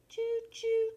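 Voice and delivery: high, piping choo-choo like a train whistle; a child's train whistle. Pretend. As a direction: toot it high like a toy train. Train arrives in the story.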